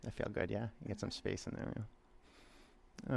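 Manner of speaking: very soft, breathy murmur; near whisper. Real, not acted. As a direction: murmur right beside them. Murmured close to student.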